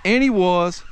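calling a fish in, voice rising with each repeat; excited. Real, not acted. Angler landing a trout he predicted, real excited calls. Fish hooked right off the point.

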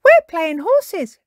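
high, loud, drawn-out cry; excited cry. A narrator's reading. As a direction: call it out gleefully as a child character. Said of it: "cried Peppa".

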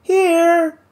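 high, lilting call from far up; pleasant sing-song. Acted. Kate answering from the treetop, high sing-song call. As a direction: sing the word out lightly from above. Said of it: "came a pleasant sing-song voice".

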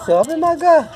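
high-pitched coaxing call; high cheering on. Real, not acted. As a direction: egg the little one on in a high voice. Cheering child on to climb. (voz aguda de incentivo)

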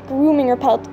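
usual speaking voice

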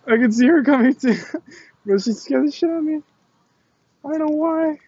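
high-pitched, squeaky amused protest; helpless laughter after a scare; laughing at own fright. Real, not acted. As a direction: squeal it out while cracking up. Laughing about being scared.

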